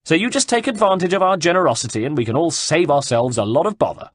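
threatening shout. A narrator's reading. Cecco gives Caterina an hour before riding off, threatening shout. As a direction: hammer the threats home across the distance.

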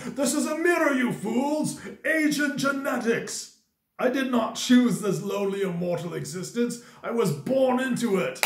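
high-pitched, hammed-up grand declamation; epic monologue voice. Showing viewers his younger self, epic monologue beast mode. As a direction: rail at the fools like a melodramatic immortal. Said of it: "me doing my epic monologue voice".

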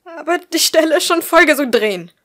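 high, loud burst of panic; panicked protest. Acted. Bleeding woman protesting that the roll is soaked, panicked. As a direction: blurt it out, high and frantic.

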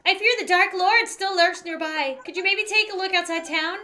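high, worried character voice; Kirby the mayor. Acted. Mayor fearing the lurking villain, high worried voice. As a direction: plead gently in a cute high register.

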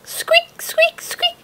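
tiny high squeaks like a mouse; squeaky mouse. Acted. Mouse voiced in story.

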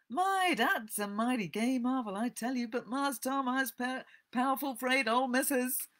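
put-on high, tempted but hesitant Southern dialect accent; Jim. A narrator's reading. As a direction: waver aloud in old Southern American dialect. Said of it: "Sorry about the accent".

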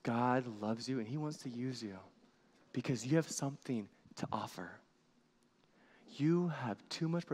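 usual speaking voice

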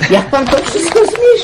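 high, marvelling question; amazed question. Acted. The aunt marvels at the guest's pack, in high amazement.

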